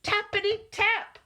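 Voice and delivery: high, crisp knock sound words; sudden knocking. A narrator's reading. Narrator voicing the knock at Owl's door, high tapping.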